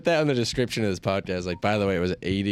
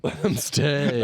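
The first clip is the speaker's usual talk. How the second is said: high, slow whiny plea; mock pleading; 6 semitones higher, much slower than usual. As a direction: whine it slowly, higher.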